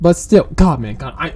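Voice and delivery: usual speaking voice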